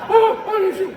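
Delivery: high, loud mocking yelp; mocking the buddy. Wife mocks husband's buddy on the phone, loud high yelp. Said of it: "You sound like my buddy".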